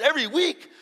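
high, loud incredulous question; retold disbelief. Newcomer questions friend about weekly healings, incredulous. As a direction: blurt it out high, rising in disbelief.

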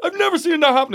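very high-pitched retold disbelief; mock amazement. Steward on the stuck earbud, mock amazement.

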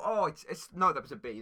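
ordinary talk

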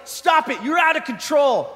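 high, urgent mock shout; mock referee command. Referee stopping a wild boxer, high mock shout. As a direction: call a halt like an alarmed referee.

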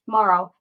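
Mauro said like Morrow, MOR-oh; correct pronunciation. Shown as a demonstration. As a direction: say Mauro as if spelled M O R R O W. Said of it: "the way you spell it is M O R R O W".

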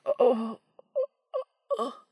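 rising strangled oh sounds; strangled moans. Acted. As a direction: choke out the moans. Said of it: "strangled cry".

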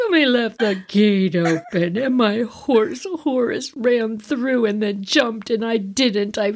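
mock tearful, rising whine; crying. Crying child explaining her fall, mock tearful. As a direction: sob out the story like a little kid. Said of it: "And I'm crying".

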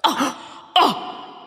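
high, airy mock gasps; retold gasps of shock; imitated shocked gasp. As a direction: gasp twice as if scandalized. Classmate's shocked reaction, retold.

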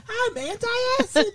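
very high, drawn-out cartoon voice; slow, high-pitched mascot voice; talking Tums. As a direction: squeak it like a little talking antacid. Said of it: "where it's a talking Tums".

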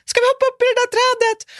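very high, loud desperate question; panicked. Retold or imitated. Begging Emilia to climb the tree, shrill high panic. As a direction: plead it in near hysteria.

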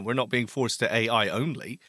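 ordinary talk, nothing marked

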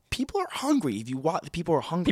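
outraged rant; exasperated. Real, not acted. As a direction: voice jumps high, indignant. Ranting about Bran, pitch jump.

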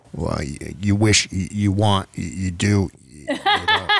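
low, stammering evasive politician; Bill Barr. Acted. Host mocks Barr dodging the question, deep stammering impression. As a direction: stall in a deep voice, hunting for words. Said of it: "And then Bill Barr says".

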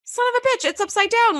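pitched well up, a startled curse; mock outrage. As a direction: blurt it out as if catching the blooper. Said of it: "And I was like".

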